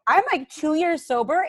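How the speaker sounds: hammed-up gasp of disbelief; retold shock. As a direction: replay the jolt of realising, half-laughing. Retold shock at pot bowl.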